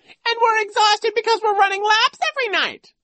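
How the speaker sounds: piercingly high, loud mimicry; Nero squealing. A narrator's reading. Vice principal parrots the boy's complaint, squealing mockery. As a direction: squeal it back in mocking falsetto. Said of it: "he squealed".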